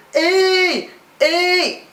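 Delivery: slow mock "ei" with a boyish put-on; mocking boys' sound. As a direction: imitate a boy faking a pretty voice. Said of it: "Aí ficam".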